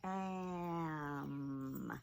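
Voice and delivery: AM drawn out, vowel sliding into a long m; sounded out slowly. Shown as a demonstration. Teacher revealing the new word ham, sounded out slowly. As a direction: stretch AM slowly, holding both sounds. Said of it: "So now our word is".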